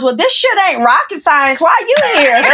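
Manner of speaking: high-pitched comic disbelief; baffled coworker. Retold or imitated. Coworker questioning why a NASA physicist works here, comic bafflement. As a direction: imitate an incredulous coworker, voice climbing.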